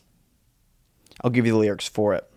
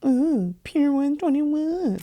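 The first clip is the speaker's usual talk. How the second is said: mock high squeal; 13 semitones higher, 5 dB quieter, much slower than usual. Mocking the pier name.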